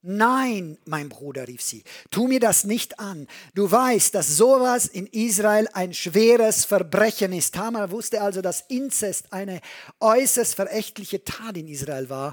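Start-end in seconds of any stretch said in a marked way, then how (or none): 0.0-7.5 lauter und höher, verzweifelt flehend; flehende Abwehr. Acted. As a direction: wehr dich verzweifelt gegen den Bruder. Tamar wehrt sich. (Tamar fleht den Bruder lauter und höher an)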